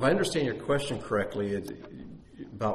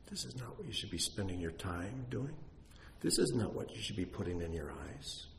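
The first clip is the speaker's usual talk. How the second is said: much softer, gentle correction; still small voice; 11 dB quieter than usual. Retold or imitated. Pastor voicing God's quiet voice about a TV show, soft. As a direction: rebuke tenderly, under the breath. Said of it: "that voice says".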